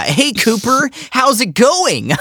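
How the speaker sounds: high, drawn-out American greeting; California accent. Acted. Co-host echoes the greeting to Cooper, California accent. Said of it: "please do a California accent".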